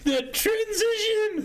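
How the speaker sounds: high drawn-out shout; slow high excited yell. Real, not acted. Excited at music change.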